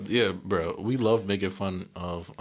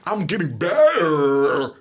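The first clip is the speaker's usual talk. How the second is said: mock American accent; 8 semitones higher, 6 dB louder, much slower than usual. Foreigners mocking how Americans say R, in a mock American accent. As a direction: drag out a hard R. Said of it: "he's like".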